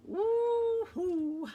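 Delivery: high, loud woo-hoo; excited cheer. Real, not acted. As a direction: whoop with delight. Excited cheer over new dies.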